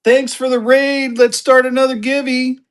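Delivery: high, projected hype voice; hype call-out. Real, not acted. Hyping raid and giveaway to viewers.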